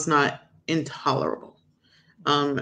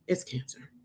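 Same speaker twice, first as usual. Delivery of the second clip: much softer, low-key retold diagnosis; hushed verdict; 3 semitones lower, 11 dB quieter than usual. Doctor answering her question about the cells, hushed. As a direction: deliver the bad news quietly.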